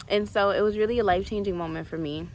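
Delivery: ordinary delivery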